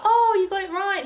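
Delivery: high, bright mock-polite chirp; fake cheerful reply. To the guessing guy at the Olympics, high forced cheer. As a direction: play along with forced cheer. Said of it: "I was like".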